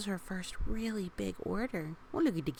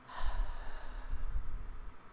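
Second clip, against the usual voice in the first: long breath out. Shown as a demonstration. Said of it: "breathed in slowly".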